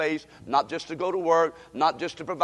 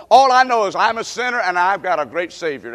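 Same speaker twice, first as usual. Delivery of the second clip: normal talking voice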